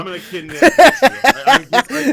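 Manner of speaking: very loud, put-on menace; mock-intimidating voice. Threatening to kidnap a small friend, mock-intimidating voice. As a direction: boom it out as a playful threat. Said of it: "trying to be intimidating".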